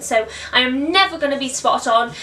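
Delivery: ordinary talk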